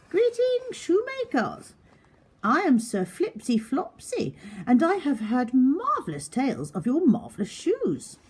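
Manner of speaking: high, pompous knight greeting the shoemakers; grand knight voice. A narrator's reading. Sir Flipsy Flopsy greets shoemakers.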